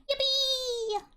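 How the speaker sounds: squealed high cheer; high yippee. Real, not acted. Cheering a viewer.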